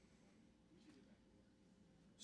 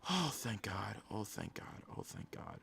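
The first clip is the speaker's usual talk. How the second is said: soft, low, fading relief; spent, sinking into near-whisper; hushed relief; 9 semitones lower, 12 dB quieter than usual. Real, not acted. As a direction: murmur it as tension drains away. Relief after the next pick.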